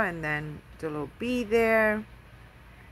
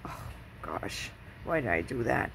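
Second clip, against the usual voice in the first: low, soft groan of regret; hushed, sinking self-reproach; mock regret; 8 semitones lower, 6 dB quieter than usual. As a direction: sigh it low, replaying your dismay. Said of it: "Because I had a hard time".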